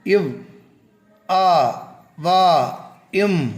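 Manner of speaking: WARM split into இவ், ஆ, வா, இம்; each sound alone, இவ், ஆ, வா, இம், r left silent; phonics sounding-out. Shown as a demonstration. Teacher showing learners a silent R, sounding out. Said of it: "அந்த உச்சரிப்பின் மூலமாக".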